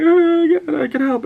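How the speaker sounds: high-pitched imitation of tearful begging; mock crying plea. Wounded young man begging Joel, mock crying plea. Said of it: "he starts crying".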